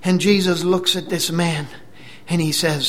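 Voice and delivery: usual speaking voice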